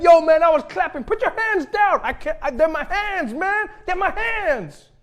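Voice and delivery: high, loud, desperate shouting; yelling in alarmed disbelief; panicked clapper protesting. Acted. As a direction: plead at the top of your voice. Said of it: "Be like".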